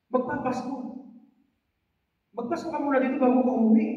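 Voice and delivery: high, quick pleading friend; high, lively friendly coaxing; pleading classmate. Retold or imitated. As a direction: coax him to stay for Christmas.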